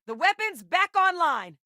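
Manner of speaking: high-pitched, urgent shout of relief; sudden high-voiced announcement; excited alert. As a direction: call out the good news urgently. Alert in a game line.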